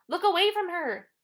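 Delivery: high, loud warning cry; shout. A narrator's reading. Annabeth warning the boys about Medusa, shouting. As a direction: yell it to save them. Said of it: "Annabeth shouted".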